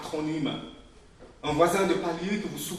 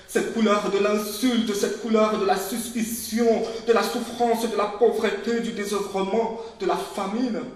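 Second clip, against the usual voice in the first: loud, higher, building litany on "couleur"; furious tirade; 5 semitones higher, 8 dB louder than usual. Acted. Daughter raging at mother's skin products, loud and building. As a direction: let the rage build with each "couleur".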